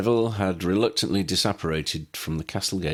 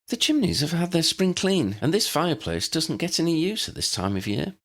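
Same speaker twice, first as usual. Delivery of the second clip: higher, friendly woman's voice; Hannah's voice; 11 semitones higher than usual. Acted. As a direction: speak as a kindly landlady. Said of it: "Hannah smiled".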